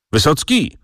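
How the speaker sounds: called out his name, pitched well up; calling out. A narrator's reading. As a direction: call Wysocki back as he walks away. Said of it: "zawołał".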